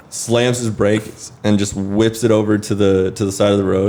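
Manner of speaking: normal talking voice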